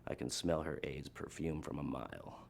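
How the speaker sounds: soft, low imitated agent; low deadpan confiding. Secret Service agent confiding in the musician, low and quiet. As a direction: keep it down, dropping in pitch.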